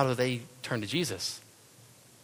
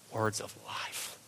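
savoring whisper; 6 dB quieter, slower than usual. Real, not acted. Savoring the phrase.

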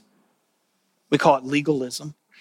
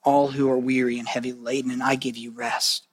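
gentle Jesus; 4 semitones lower, 5 dB quieter than usual. Acted. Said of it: "But Jesus says".

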